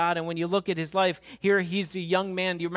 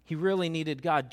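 usual speaking voice

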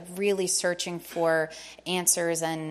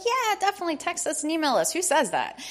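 excited reply; 10 semitones higher than usual. Retold or imitated. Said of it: "And we were like".

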